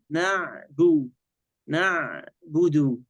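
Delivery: نعبد very slow, ba light and distinct with u; light clear ba. Shown as a demonstration. Sheikh shows the ba's articulation point, slow syllables. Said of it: "الباء مرققة".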